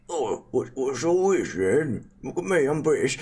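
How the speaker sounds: stagey dwarf putting on British airs; a jokey Brit-dwarf voice; mock British. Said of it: "it's a dwarf".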